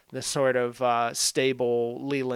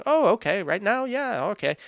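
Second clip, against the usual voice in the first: higher-pitched, hesitant going-along; acted agreeable woman; 8 semitones higher than usual. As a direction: imitate her agreeing in a lifted voice. Acting the lured woman agreeing.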